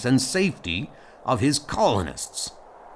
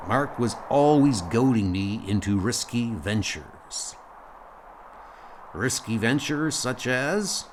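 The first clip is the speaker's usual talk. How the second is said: usual speaking voice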